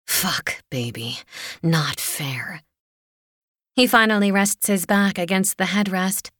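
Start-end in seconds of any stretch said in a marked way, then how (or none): 0.1-2.6 hushed, breathy-edged growl; rough whispered growl. A narrator's reading. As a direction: whisper it roughly into her neck. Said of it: "David growls roughly into my neck".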